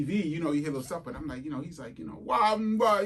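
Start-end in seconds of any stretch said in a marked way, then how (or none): 2.2-3.0 a light mock Jamaican accent, higher and louder; imitating Jamaican accent. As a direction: toss off a quick Jamaican-accented sound. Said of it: "he's talking like that".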